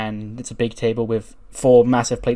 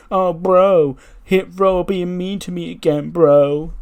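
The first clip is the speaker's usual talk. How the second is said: high, loud, drawn-out bro voice; mock crybaby whine; 7 semitones higher, 6 dB louder, slower than usual. Champion imitates rival crying to his bro, mock whine. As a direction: mockingly wail like a sulking dude. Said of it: "he can cry".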